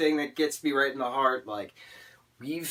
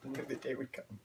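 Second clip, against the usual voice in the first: quiet repeat; 2 semitones higher, 10 dB quieter, faster than usual. Real, not acted.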